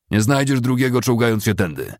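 high, loud scolding; scornful officer. A narrator's reading. Officer scolding a soldier in the ditch, loud scorn. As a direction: sneer at the crawling soldier.

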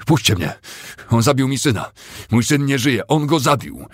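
high, loud, desperate outburst; anguished yelling, rising in pitch; frantic shouting. Acted. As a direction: cry out while being dragged away. Restrained Cooper raves about his son.

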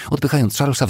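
ordinary delivery

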